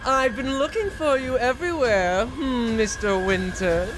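slow, dramatic villainess voice; seductive vampire lady. Acted. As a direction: purr it like a tall vampire countess. Impersonating Lady Dimitrescu.

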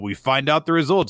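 normal talking voice

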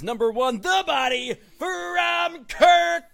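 shouted, high, slow wrestling intro; announcer hype. Acted. Host introducing jobber to listeners, ring announcer shout. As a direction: call out the name like a ring announcer.

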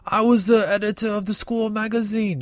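high put-on rocker voice. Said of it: "the lead singer of Motorhead say".

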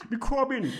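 high mock Jamaican accent through a laugh; fake Jamaican accent. Explaining his dancing to the girl, fake Jamaican accent. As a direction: put on Jamaican, amused at yourself. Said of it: "in my, um, fake Jamaican accent".

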